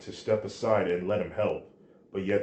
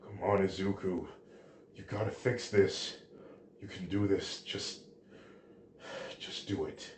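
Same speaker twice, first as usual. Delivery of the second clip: soft, drained pep talk to oneself; hushed, faltering plea to yourself; quiet self-urging; 7 dB quieter than usual. A narrator's reading. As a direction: urge yourself on under your breath, fading. Drained hero urges himself on.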